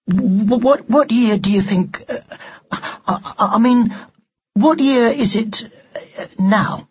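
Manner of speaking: put-on high, halting boy's voice with false starts; flustered stammer. Ned nervously asking the doll what year it is, stammering. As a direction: stumble towards the question, breaking off.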